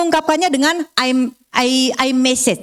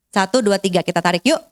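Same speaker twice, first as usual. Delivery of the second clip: slow counted breathing; 7 semitones lower than usual. Shown as a demonstration. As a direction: guide the breath calmly, counting slowly. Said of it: "Yuk kita praktek ya".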